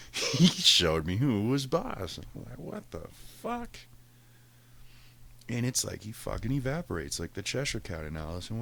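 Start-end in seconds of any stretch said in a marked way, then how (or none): 2.6-3.8 baffled. Retold or imitated. Cat vanishes, baffled.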